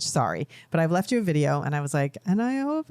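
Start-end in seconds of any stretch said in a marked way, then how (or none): 2.3-2.9 high mock-tearful wail, words melting away; imitated crying. Mother re-enacting her tearful birthday message, mock crying. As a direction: imitate blubbering through a message, pitch way up. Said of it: "and I'm, like, crying".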